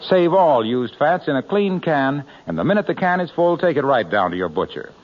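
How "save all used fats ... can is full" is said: ordinary talk, nothing marked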